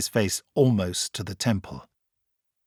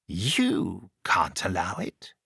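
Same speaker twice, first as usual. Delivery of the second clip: softly; 2 semitones lower, 5 dB quieter, much slower than usual. A narrator's reading. Commander questioning the Doctor, soft and slow. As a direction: ease the question out quietly, very slowly. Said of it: "he said softly".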